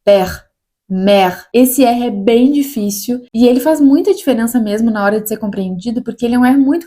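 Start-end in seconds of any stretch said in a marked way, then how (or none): 0.0-1.5 Père and mère with a throaty scraped R; guttural French R. Shown as a demonstration. Teacher giving French R examples to students. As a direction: let the R rasp in the throat. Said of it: "Outros exemplos".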